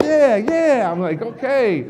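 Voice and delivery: loud, high excited yeahs; hyped up. Retold or imitated. Cheering along with the hyped-up crowd, excited. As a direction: caught up in the crowd's hype. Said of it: "naturally kind of get into the moment kind of guy".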